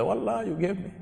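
high-pitched, lightly acted tearful plea; imitated weeping. Converted thief thanking his host, mock tearful. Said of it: "the man is weeping and crying".

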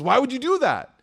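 high, loud imitation of a panicked husband; loud retold protest; alarmed reproach. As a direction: act out an alarmed husband, pitch jumping up. Retold husband alarmed at wife's confession.

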